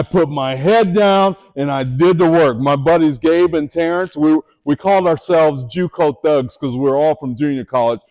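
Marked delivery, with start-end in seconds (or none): none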